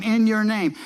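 "In your name" stressed, pitch up on "In" then falling; emphasized phrase. Retold or imitated. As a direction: punch out "In your name" as a claim. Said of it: "They emphasize that every time".

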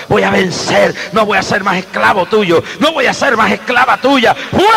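building fervent sermon voice; rising from hushed to full preaching force. Real, not acted. Predica a pleno pulmón. (predicación creciente, de susurro a fuerza plena)

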